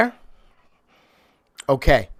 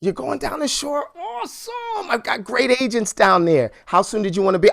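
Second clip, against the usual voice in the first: mock delighted agent; 7 semitones higher than usual. Shown as a demonstration. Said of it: "agent reacting to the seller".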